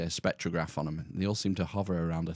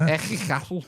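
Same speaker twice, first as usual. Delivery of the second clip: Ejicatl said in a higher, louder Scouse accent; 8 semitones higher, 4 dB louder than usual. Shown as a demonstration. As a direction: say Ejicatl like a Liverpudlian.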